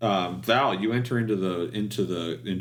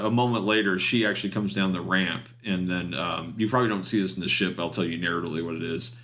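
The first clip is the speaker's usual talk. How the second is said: ordinary delivery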